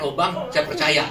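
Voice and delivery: slow, loud, laughing fake-believer line; mock gullible believer. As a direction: ham up a gullible convert, laughing.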